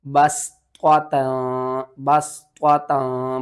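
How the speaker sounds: बस त्वा ता slowly, heavy त्वा then light held ता; measured बस त्वा ता, last vowel drawn out; correct letter contrast. Shown as a demonstration. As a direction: separate the thick त्वा from the thin nasal ता. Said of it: "किस तरह पढ़ना है".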